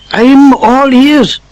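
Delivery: eager Sultan. Acted.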